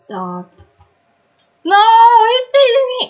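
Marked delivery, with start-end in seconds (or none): 1.6-3.1 high, loud panicked cry; panicking gamer. Real, not acted. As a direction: scream it while fleeing. Chased by monster in game.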